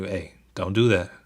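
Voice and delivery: normal talking voice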